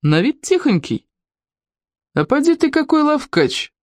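slow start, then high exclamation; impressed exclamation. A narrator's reading. A policeman sizes up the runaway boy, impressed. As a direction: marvel at the sly one.

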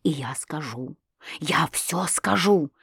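low, hushed threat; menacing undertone. A narrator's reading. Husband threatens to reveal everything, menacing undertone. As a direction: drop low and vow it slowly.

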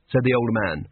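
plain reading voice

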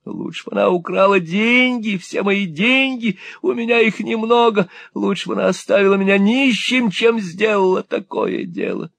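put-on a robbed old man shrieking in panic; frantic old miser. A narrator's reading.

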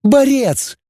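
very high, defiant character voice; high-pitched, defiant self-declaration; proud girl. A narrator's reading. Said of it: "гордо вскинула голову девица".

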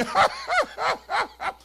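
high, loud mock guffaw; exaggerated ha-ha-ha laugh; imitated hysterical laughter. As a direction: act out a fit of helpless laughing. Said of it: "he was laughing hysterically, ... he's going".

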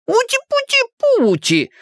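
very high, drawn-out cooing; squeaky teasing baby talk; mocking baby-talk exclamation. A narrator's reading. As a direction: coo as if pinching a baby's cheek. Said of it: "воскликнул Ричи".

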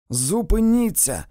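loud, high shout. A narrator's reading. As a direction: shout to make it stop. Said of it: "закричав він". (крик)

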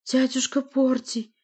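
high, slow, pleading call; imploring young woman. Acted. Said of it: "молящим голосом обратилась Валерия".

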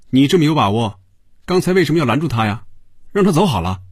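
high-pitched irritated questioning; testy, agitated retort; peevish challenge. A narrator's reading. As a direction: throw the blame back at him. Tony, rattled, sneers at colleague.

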